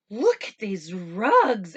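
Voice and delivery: slow, loud wonder; slow awed exclamation; admiring mother. Acted. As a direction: marvel at the rugs. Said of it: "Anna's mother said".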